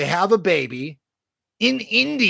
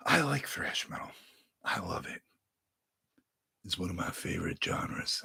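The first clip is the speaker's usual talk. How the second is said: much lower and softer, murmured odd voice; 8 semitones lower, 11 dB quieter than usual. Shown as a demonstration. Vlogger praising thrash metal in an odd low murmur. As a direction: drop into a strange low murmur. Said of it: "I don't know why I'm talking like that".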